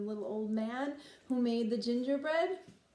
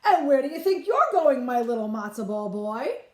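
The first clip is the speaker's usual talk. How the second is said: put-on old bubby voice; 8 dB louder than usual. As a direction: call after him like a fussing bubby. Bubby scolding the runaway.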